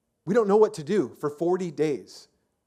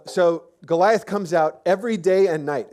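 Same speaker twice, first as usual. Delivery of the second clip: normal talking voice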